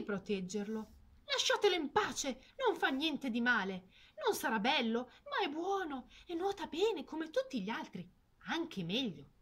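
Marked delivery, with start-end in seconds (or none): 1.2-9.3 protective mother. Acted. Mother defends duckling.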